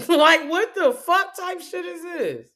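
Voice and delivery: high, slow disbelieving cry; incredulous outburst. Real, not acted. Venting at doubters, high incredulous outburst. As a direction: let the disbelief ride up high.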